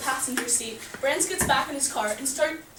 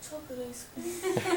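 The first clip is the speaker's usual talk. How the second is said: small, slightly high put-on voice; 3 semitones higher than usual. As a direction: mumble a drive-through order as a joke. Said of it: "please, like, speak up or leave".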